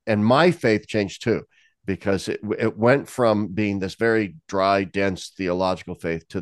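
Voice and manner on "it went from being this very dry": usual speaking voice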